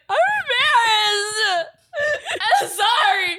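high, slow whiny wail; whiny mock apology. Mocking own embarrassment, high slow whiny wail. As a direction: drag it out like a sulky kid. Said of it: "I just be like".

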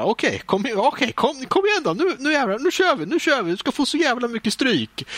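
self-pumping battle cry that climbs high; pumped up. Retold or imitated. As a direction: psych yourself up like before a fight. Psyching himself up to fight.

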